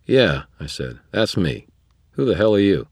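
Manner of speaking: the wizard snapping at the smug vampire; hostile retort. A narrator's reading. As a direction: bark it back at the intruder. Dresden snapping at stranger.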